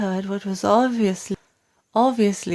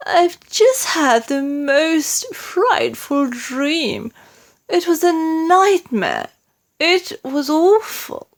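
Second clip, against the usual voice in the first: shaken queen; 8 semitones higher, slower than usual. A narrator's reading. Queen telling her nightmare.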